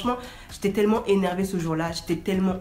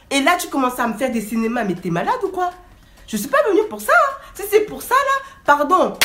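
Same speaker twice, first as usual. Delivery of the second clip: scolding; 7 semitones higher than usual. Retold or imitated. She scolds the man.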